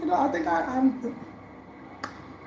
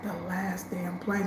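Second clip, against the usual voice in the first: softer, slow, sinking; hushed dread; 3 semitones lower, 7 dB quieter, slower than usual. Retold or imitated. Realizing she is stranded, slow hushed dread. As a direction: let the words drop as it sinks in.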